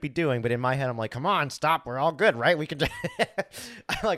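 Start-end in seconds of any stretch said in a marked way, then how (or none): 1.1-2.9 higher and louder, hurried urging; mock frantic plea; impatient pleading. Inner pleading during meeting. 3.0-4.0 high burst of laughter; amused laugh at himself. Real, not acted. Laughs at himself mid-story.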